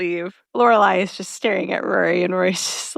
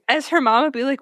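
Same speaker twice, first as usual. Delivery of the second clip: ordinary talk, nothing marked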